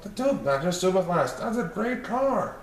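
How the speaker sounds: put-on little kids admiring a shiny car; excited children. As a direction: gush like small kids over a car. Children's voice continues.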